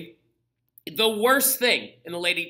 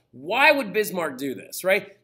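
normal talking voice

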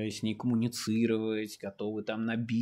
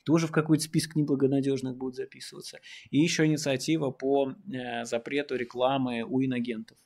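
ordinary talk

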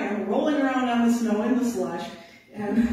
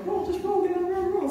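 much higher, quick self-instruction; urgent self-coaching; 10 semitones higher than usual. Retold or imitated. Musher rolling out of sled's path, high urgent self-talk. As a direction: chant it to yourself urgently. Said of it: "you know".

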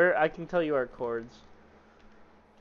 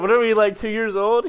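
loud, high exasperated shout; exasperated yell; 7 semitones higher, 12 dB louder than usual. Real, not acted. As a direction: yell it in disbelief. Friends squabbling, exasperated outburst.

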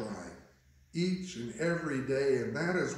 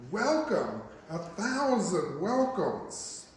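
higher, drawn-out joyful greeting; effusive welcome; 5 semitones higher, slower than usual. Acted. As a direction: welcome an honored guest with open arms. Throws open arms to greet Jesus.

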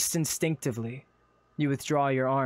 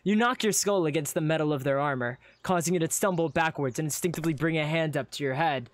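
ordinary talk, nothing marked

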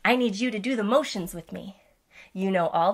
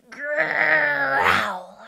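loud growly lion roar; play-growl for the kids; demo growl; 6 semitones higher, 6 dB louder than usual. Shown as a demonstration. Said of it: "I can go".

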